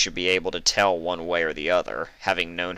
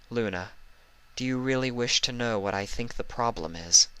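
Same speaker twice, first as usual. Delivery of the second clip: soft, lowered, tender asking; gentle question; 4 semitones higher, 4 dB quieter than usual. Acted. Celestia gently asking her sister if she wants the truth, soft. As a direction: ask her kindly, quietly. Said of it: "she asked".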